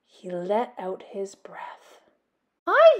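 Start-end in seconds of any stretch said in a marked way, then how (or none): 2.7-3.0 eager hi. Acted. Little Bat greeting the bats.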